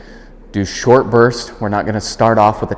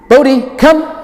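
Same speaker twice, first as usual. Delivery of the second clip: much louder and higher, firm call; loud clear recall; 7 dB louder than usual. Real, not acted. Trainer calling the dog back, loud and clear. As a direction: call the dog across the room. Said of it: "It's louder than my normal speaking cadence".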